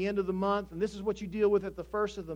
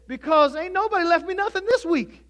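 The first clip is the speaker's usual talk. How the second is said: loud, high-pitched comic complaint; aggrieved heir; 10 semitones higher, 10 dB louder than usual. Retold or imitated. Sad heir complains to his friend, aggrieved. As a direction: wail the punchline as a mock grievance.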